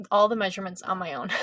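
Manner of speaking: ordinary talk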